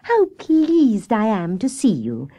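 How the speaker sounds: high, drawn-out glad greeting; pleased, lingering welcome; delighted cry. A narrator's reading. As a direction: cry out slowly with pleasure at a friend. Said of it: "she cried when she saw her friend".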